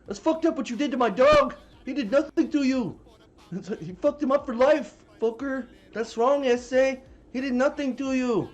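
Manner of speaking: high, loud, put-on angry voice; mock furious rant. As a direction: rant like the dog owner who wants revenge. Dog owner rants at Craig.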